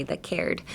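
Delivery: CARED with a strongly aspirated k; stressed K. Shown as a demonstration. Teacher showing the cared part, hard airy K. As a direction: lean on the K at the start of cared. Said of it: "Emphasis on the K".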